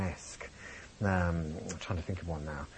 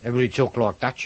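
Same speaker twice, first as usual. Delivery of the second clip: imitated Birmingham accent, louder; 2 semitones higher, 6 dB louder, faster than usual. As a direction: put on a Brummie accent like the schoolmates. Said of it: "I went to school in Birmingham".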